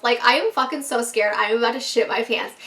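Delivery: ordinary talk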